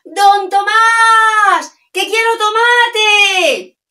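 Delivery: loud, high, very slow sung-out shout; shouted call. Acted. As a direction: call out across the shop to the deaf grocer. (grito alto y cantado)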